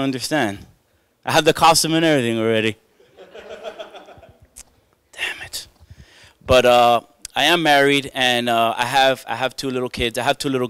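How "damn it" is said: unvoiced disappointed whisper; whispered damn it. Giving up the ninja dream, whispered. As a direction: breathe it out, crushed, under his breath.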